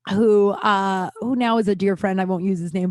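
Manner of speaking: normal talking voice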